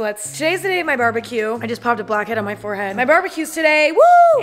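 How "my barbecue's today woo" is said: loud, high whoop of joy; excited cheer. Real, not acted. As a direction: cheer like it's a party. Excited about her barbecue.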